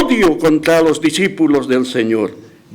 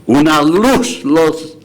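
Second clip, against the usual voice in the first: ordinary talk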